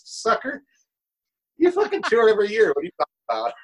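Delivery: high, fast mock jeer of the wife; wife ribbing husband, high and mocking. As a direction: toss it back like a teasing wife. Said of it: "She goes".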